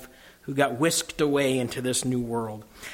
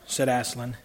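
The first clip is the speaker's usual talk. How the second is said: normal talking voice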